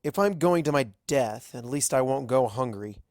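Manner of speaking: ordinary delivery, nothing marked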